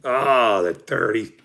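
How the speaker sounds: ordinary talk, nothing marked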